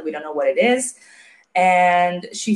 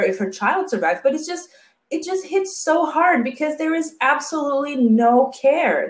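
ordinary talk, nothing marked

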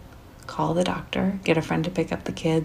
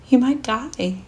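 sobbing; 7 semitones higher, slower than usual. Retold or imitated. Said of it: "I sob".

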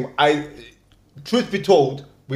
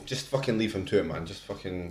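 dismissive mutter; 10 semitones lower, 14 dB quieter than usual. Retold or imitated. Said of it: "me or you may go".

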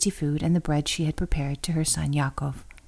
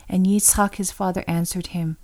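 ordinary delivery

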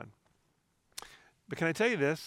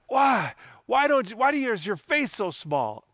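high, loud hammed-up rebuke; mock scolding; 8 semitones higher, 5 dB louder than usual. Imagined Jesus berating his followers, mock scolding. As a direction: scold as if wagging a finger. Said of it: "I don't believe Jesus was shaking his finger".

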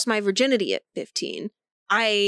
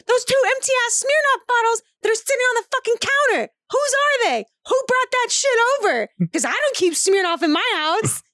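high-pitched, indignant retold rant; losing it; 11 semitones higher than usual. Mom grilling her son about Smirnoff bottles, retold losing it. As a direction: grill them in a raised, high voice. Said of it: "I lost my shit".

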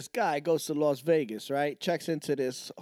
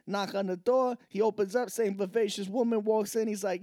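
ordinary talk, nothing marked

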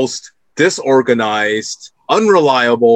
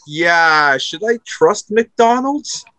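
doubtful LeBron James; 6 semitones higher than usual. Retold or imitated. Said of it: "it's like".

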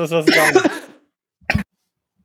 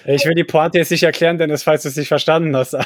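ordinary talk

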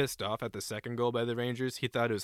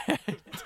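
high laugh; 13 semitones higher than usual. Real, not acted. Laughing at the scene.

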